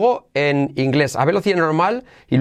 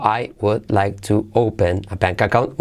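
separated words; 4 semitones lower, slower than usual. Shown as a demonstration.

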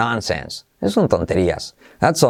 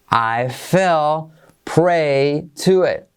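slow, drawn-out model phrase; slow teaching read; very slow demo; much slower than usual. Shown as a demonstration. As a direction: stretch every word for learners. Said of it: "Caí presa".

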